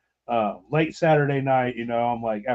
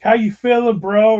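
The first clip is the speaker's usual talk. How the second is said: teasing cheer; 8 semitones higher, 6 dB louder than usual. Retold or imitated. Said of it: "I was like".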